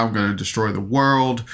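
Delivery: usual speaking voice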